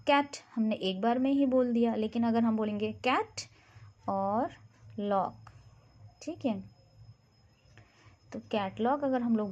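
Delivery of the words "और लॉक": usual speaking voice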